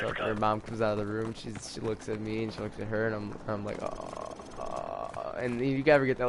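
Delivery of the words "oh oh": low, quiet, awkward "oh". Retold or imitated. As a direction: drop it low and small, caught out. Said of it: "that really awkward feeling".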